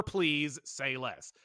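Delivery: ordinary talk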